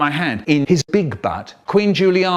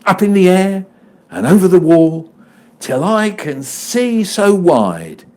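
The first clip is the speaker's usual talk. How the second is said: put-on high, louder reading of a children's poem, slowing at the close; poem recital; 5 semitones higher, 6 dB louder than usual. Reading The Swing aloud for the audience, recital voice. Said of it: "willing to perform The Swing".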